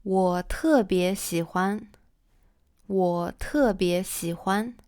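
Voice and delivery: "我特别喜欢" said slowly, twice, each tone clear; slow model sentence. Shown as a demonstration. Teacher repeats "我特别喜欢" slowly for students. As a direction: let "我特别喜欢" unfold slowly for learners.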